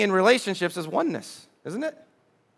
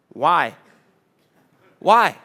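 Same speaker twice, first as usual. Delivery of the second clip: disapproving one-word challenge; judging "why"; 3 semitones lower, 4 dB louder than usual. Acted. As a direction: question it with a certain look. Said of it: "with a certain look".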